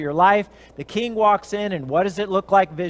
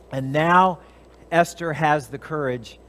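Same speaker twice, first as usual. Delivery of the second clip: ordinary talk, nothing marked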